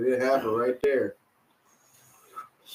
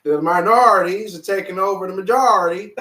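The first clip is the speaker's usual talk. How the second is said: put-on Irish accent; 5 semitones higher, 5 dB louder than usual. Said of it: "My Irish accent is horrible".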